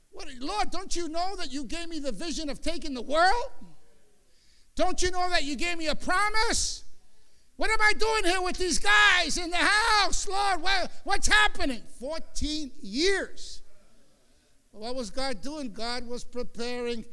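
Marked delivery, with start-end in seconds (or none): 0.1-11.8 higher, loud, desperate shout to God; shouting complaint. Retold or imitated. Preacher reliving his shouted complaint to the Lord. 12.0-13.6 dragged out very slowly. Acted. Preacher stretching the long waiting years.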